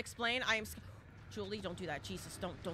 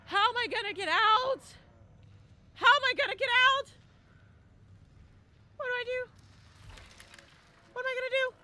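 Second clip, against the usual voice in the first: panicked; 12 semitones higher, 6 dB louder than usual. Real, not acted. Panicked streamer trapped by killer.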